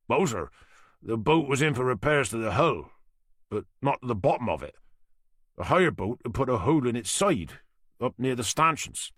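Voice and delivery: a Norfolk boatyard man answering the inspector; thick Norfolk accent. Acted. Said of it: "he said with a thick Norfolk accent".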